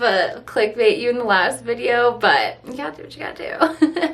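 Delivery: ordinary talk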